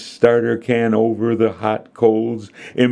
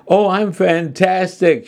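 high-pitched, gleeful gushing; laughing delight; 11 semitones higher than usual. A narrator's reading. Mary chatting happily on the phone, gleeful. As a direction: gush happily at a much higher pitch. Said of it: "she said, laughing".

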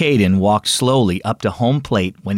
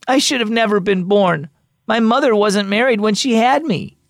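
put-on upset boy; 9 semitones higher than usual. A narrator's reading.

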